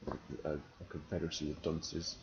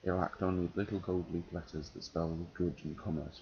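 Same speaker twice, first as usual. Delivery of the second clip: reading aloud; 4 dB louder than usual. Acted. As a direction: read the novel passage to an audience. Reading a passage from a novel.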